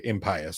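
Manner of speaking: impious as im-PIE-us; long-i pronunciation. Shown as a demonstration. Citing other dictionaries, impious said im-PIE-us. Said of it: "is the right way to say it".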